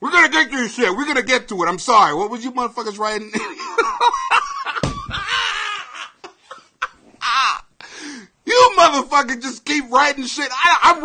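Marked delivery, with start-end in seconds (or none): 3.4-6.3 long high-pitched cackle; helpless high laughter; real laughing fit. Real, not acted. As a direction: burst into a long, squealing laugh. Cracks up at viewers' messages.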